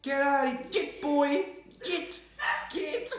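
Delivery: very high, loud shouts like driving off a dog; shooing shout. Pretend. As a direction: yell "Get!" to chase them away. Chasing aliens off with sticks.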